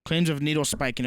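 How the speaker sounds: normal talking voice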